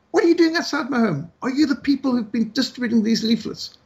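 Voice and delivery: mock outraged challenge, voice jumped up high; demanding. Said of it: "she demanded".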